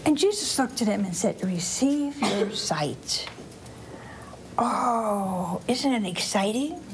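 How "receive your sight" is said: very slow, stretched words; measured, lightly acted command; solemn pronouncement. Jesus speaks healing to the blind man, solemn pronouncement.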